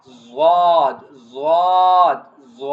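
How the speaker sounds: "Zwad" loud with a long full vowel; heavy vowel. Shown as a demonstration. Teacher showing tongue to the side teeth, heavy "Zwad". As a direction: lean heavily on "Zwad" and stretch it. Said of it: "heavy vowel".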